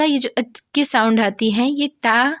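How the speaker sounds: ordinary talk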